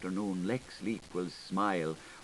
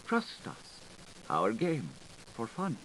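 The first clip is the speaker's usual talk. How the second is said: whisper; 5 dB quieter than usual. Acted. Whispered aside in reading.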